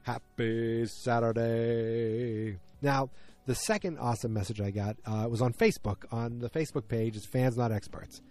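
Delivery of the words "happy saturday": very slow, stretched-out greeting; a special, slowly savored salutation; drawn-out greeting. Real, not acted. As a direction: draw each syllable right out.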